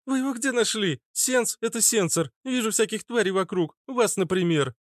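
put-on high-pitched sneering street girl; prickly redhead girl. (дерзкая девчонка, высоким голосом)

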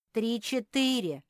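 loud, high correction; correcting. A narrator's reading. Squirrel correcting the bear's count, pointed correction. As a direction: put the right count firmly and loudly. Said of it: "поправил его Бельчонок".

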